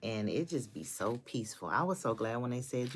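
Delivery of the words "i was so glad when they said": usual speaking voice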